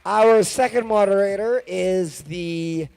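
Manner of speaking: loud, booming host introduction; announcer voice. Acted. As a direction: call the names out to the crowd. Introducing moderators to the audience.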